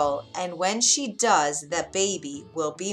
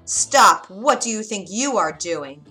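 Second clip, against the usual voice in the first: sudden shouted challenge; explosive "stop", then loud demand; loud witch's voice; 8 dB louder than usual. A narrator's reading. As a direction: catch a thief in the act, loudly. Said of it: "came a high, loud voice".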